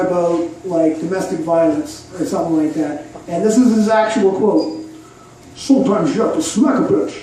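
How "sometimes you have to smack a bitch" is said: lightly voiced bad Scottish burr; a weak stab at Connery's accent; Sean Connery impression. Comedian quoting Sean Connery in a bad impression. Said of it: "I do an impression of Sean Connery".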